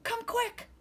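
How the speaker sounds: high urgent call; scared call. A narrator's reading. Child calls mommy to come quick, high frightened. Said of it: "he cried".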